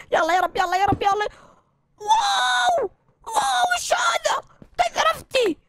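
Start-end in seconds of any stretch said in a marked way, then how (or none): 2.0-5.6 high, drawn-out exclamation of wonder; amazed shout. Real, not acted. Finding diamonds in the cave.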